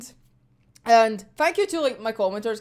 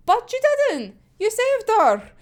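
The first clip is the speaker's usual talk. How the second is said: emphatic rebuttal; 9 semitones higher, 4 dB louder than usual. Acted. Acted argument making a point.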